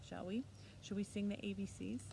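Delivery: ordinary talk, nothing marked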